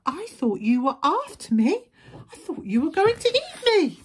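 high, loud squeal rising in alarm; scared little wolf. A narrator's reading. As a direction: blurt it out, climbing to a squeal. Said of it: "who was still a bit scared".

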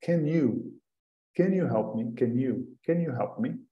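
Can you said as one run, kɛnju; soft linked Can you drill; linking can you. Shown as a demonstration. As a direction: glide can straight into you. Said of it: "Reparem como o can se liga com you".

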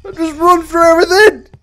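high, loud strained cry; panicked. Real, not acted. Player running through opening doors, panicked wail. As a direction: wail it while bolting blindly.

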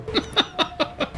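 unvoiced high giggle; breathy laugh. Real, not acted. Laughing about the failed book trick, soft breathy giggle.

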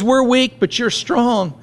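high mock-humble irony; sarcastic. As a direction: flatter them with a sneer underneath. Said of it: "he gets sarcastic again".

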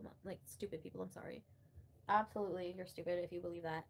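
normal talking voice

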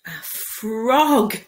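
plain reading voice

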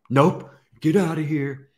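high, loud shoo at a fly; shooing yell. Retold or imitated. Shooing away a buzzing fly.

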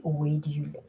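an unvoiced whisper of the English; softly whispered meaning; whispered gloss. Shown as a demonstration. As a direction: breathe the translation aside. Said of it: "whispered translation".

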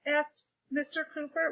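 ordinary delivery, nothing marked

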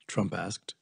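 ordinary delivery

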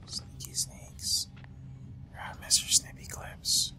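unvoiced whisper. Real, not acted. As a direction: whisper it so the monster won't hear. Sneaking past monster.

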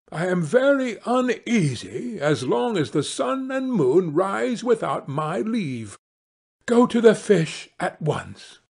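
high-pitched, indignant wife; demanding wife. Acted. Wife sending husband to the fish at once, demanding. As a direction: complain high, ending on a firm order.

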